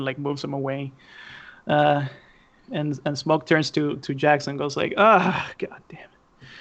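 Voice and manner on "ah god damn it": breathy sigh dropping to near whisper; exasperated sigh. Acted. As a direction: sigh out a defeated curse, voiceless. Acted weary boss sighing a curse.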